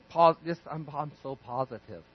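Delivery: a dreamy fake-positive murmur; mock positive thinker.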